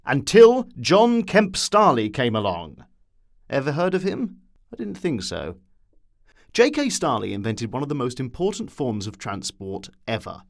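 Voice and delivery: plain reading voice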